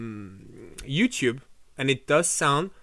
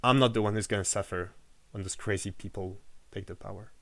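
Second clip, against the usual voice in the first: ordinary delivery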